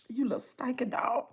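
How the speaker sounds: whispered, under her breath; whispered insult. Real, not acted. Cursing the dog under her breath in a whisper. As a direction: hiss it so nobody hears.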